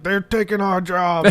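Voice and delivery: mock angry yokel. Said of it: "Like that".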